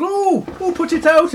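hammed-up burnt-paw yelp; mock alarm. Big Bear's paw catches fire.

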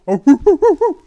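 loud, high monkey whooping; monkey hoots. Acted. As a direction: imitate an excited monkey's hoots. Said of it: "Mister Monkey saw him and he go".

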